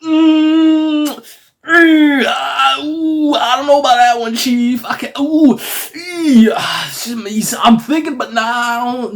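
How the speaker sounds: very high, loud squeaky hemming and hawing; comic high-pitched waffling; hammed-up reluctance. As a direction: squirm out of it in falsetto. Acted reluctance to give back control.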